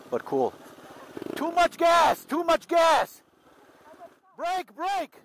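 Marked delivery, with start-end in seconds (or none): 1.4-2.2 high urgent shout of warning; nagging backseat driver. Real, not acted. Learner revving, instructor calling out. 2.2-3.2 repeated high alarmed shout; nagging backseat driver. Real, not acted. Learner revving, instructor calling out. 4.4-5.1 urgent call. Real, not acted. Learner out of control.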